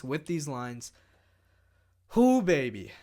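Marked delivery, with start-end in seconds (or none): 2.1-2.9 excited whoop. Real, not acted. Celebrating a win.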